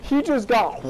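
high, loud amazed outburst; excited yell. Real, not acted. Gamer sees opponent get smacked, excited yell.

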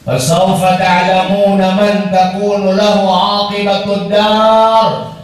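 ordinary talk

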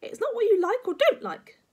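very high, loud granny outburst; cross old-woman rebuke; snapping. Acted. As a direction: snap at the boy, loud and shrieky. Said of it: "Grandma snapped".

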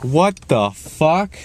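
high, slow, drawn-out disbelief; slow stunned what the fuck; shocked. Retold or imitated. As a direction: stretch it out, stunned by the hug. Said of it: "I'm like".

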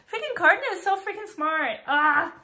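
high, loud delighted gush; gushing fangirl. Real, not acted. Fan gushing about Cardan's letter, excited squeal. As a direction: squeal with admiration.

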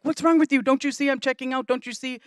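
put-on mother brushing off her kid while checking out; frustrated snap. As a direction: imitate our own impatience. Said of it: "it's like".

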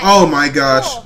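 loud shocked gasp; stunned gasp watching him eat. Real, not acted. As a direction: gasp at the feat. Reaction to fast eating.

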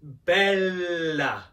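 "bella" slow, with a long held L; drawn-out Italian pronunciation. Shown as a demonstration. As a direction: linger on the double L in "bella". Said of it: "our Italian hand out and say bella".

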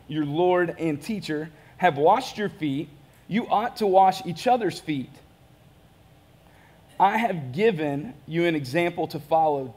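normal talking voice

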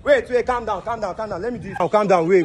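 high-pitched, urgent calming; high, insistent pleas to calm down; urgent pleading. Real, not acted. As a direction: frantically try to settle someone who is panicking. Prankster frantically calming startled victim.

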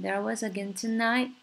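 "tonight" with its final T barely touched; swallowed T. Shown as a demonstration. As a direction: let the end of "tonight" fade. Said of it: "tonight结尾的T就很弱很弱".

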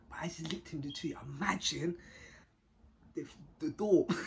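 normal talking voice

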